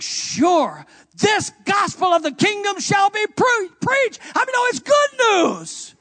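high, loud and slow preacher's cry; full-force preaching. Acted. Pastor urging the congregation, preaching at full force. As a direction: drive the gospel home.

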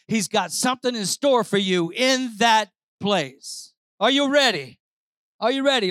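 rising Pentecostal preaching. Acted. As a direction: preach it to the rafters. Preaching at full force.

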